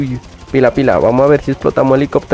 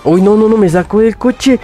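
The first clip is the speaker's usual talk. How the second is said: alarmed; 8 semitones higher than usual. Real, not acted. Dragged out of car.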